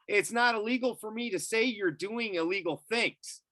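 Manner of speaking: high-pitched, insistent protest; indignant retort. Retold or imitated. Landowner answering a cop's arrest threat, high indignant retort.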